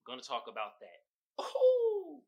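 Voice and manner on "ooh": high, delighted ooh; excited ooh. Real, not acted. Teasing Billions for viewers, a high gleeful ooh. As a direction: squeal it up high with glee. Hyped about upcoming show Billions.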